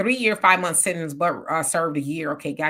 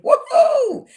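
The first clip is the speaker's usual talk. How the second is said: big high whoop, much louder; excited whoop; 8 dB louder than usual. Real, not acted. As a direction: cheer it out loud and high. Cheering a comment, excited.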